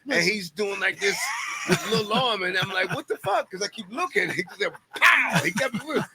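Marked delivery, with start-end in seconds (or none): 2.9-3.5 high, startled exclamation; sudden high-pitched bafflement; baffled what the fuck. Retold or imitated. Retelling surprise in fight story. 4.9-5.4 sharp, loud, high pow; loud comic punch sound; loud mock punch. Imitating punch sound in story.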